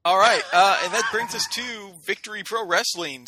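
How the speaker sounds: booming announcer voice; loud. Real, not acted. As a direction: burst back in at full volume. Loudly moving on after aside.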